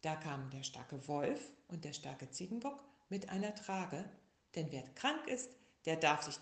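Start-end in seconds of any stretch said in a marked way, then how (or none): none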